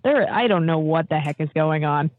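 ordinary delivery

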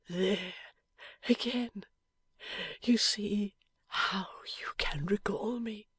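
slow, breathless whisper of a wounded man; dying man's whisper. A narrator's reading. Wounded husband whispering to his wife, faint and slow. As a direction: barely get the words out, fading.

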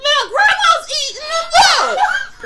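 high, loud, slow sing-song coaxing; grandma baby-talking her grandchild; mock grandma. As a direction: coax like talking to a kid. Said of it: "She was like".

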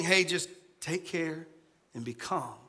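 the mild reading, softly; gentle version. Shown as a demonstration. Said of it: "more aggressive than what I just read".

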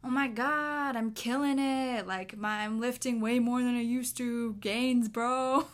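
cocky gym bro bragging loudly. Retold or imitated. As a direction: hype yourself up like a gym bro. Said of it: "they might turn to each other and say".

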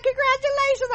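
very high sweet congratulations; mimicking cousin. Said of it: "She was like".

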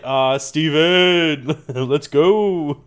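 loud, drawn-out greeting; playful calling out. Pretend. Imagined celebrity hailing director Steven Spielberg, playful loud call. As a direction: stretch the name out like hailing a buddy.

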